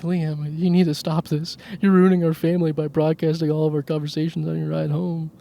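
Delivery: a mild mock of mom telling him off; mom scolding. Mom scolding him for broadcasting family calls, light mock. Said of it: "And then she's like".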